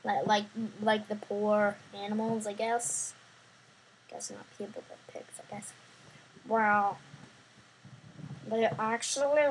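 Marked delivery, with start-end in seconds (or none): 4.1-5.7 muttered aside. Real, not acted.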